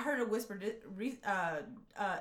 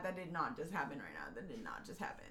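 hushed denial; 7 semitones lower, 5 dB quieter, faster than usual. Retold or imitated. Retelling her own denial.

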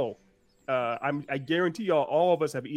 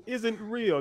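ordinary talk

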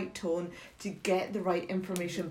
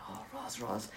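quietly voiced, mortified Italian colleague; 11 dB quieter than usual. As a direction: imitate a junior colleague dreading the news. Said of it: "he was mortified telling me".

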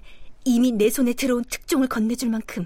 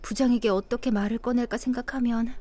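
usual speaking voice

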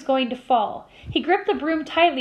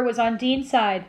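usual speaking voice

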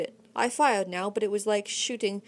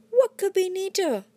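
gloating; 10 semitones higher, 4 dB louder than usual. A narrator's reading. Redhead gloating.

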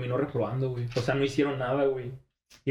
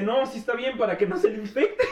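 higher, with a laugh; lightly voiced teasing teacher; 8 semitones higher than usual.

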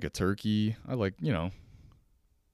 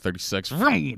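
excited vroom sound, louder and higher; mock vroom; 6 semitones higher, 5 dB louder than usual. Telling about the drunk skip operator, a vroom sound. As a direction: zoom it past like a fast elevator. Acting out elevator flying past.

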